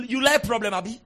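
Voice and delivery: usual speaking voice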